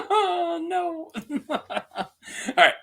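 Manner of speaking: high "oh no" through real laughter; laughing. Real, not acted. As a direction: let it rise into a laugh. Cracks up at retold bad news.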